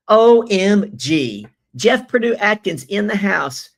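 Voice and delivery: loud, high, drawn-out OMG; thrilled to see a viewer join; excited shout-out. Real, not acted. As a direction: greet him with delight. Excited greeting of joined viewer.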